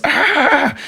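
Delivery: high, brief imitated yelp of pain; mock scream. Julie screams as glass pulled.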